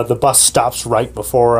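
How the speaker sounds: usual speaking voice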